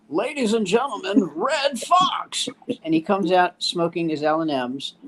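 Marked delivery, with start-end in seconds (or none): 0.1-2.5 higher, rising announcer intro; mock announcer building to the name; announcer bringing on the comedian, a high showbiz call. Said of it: "the announcer goes".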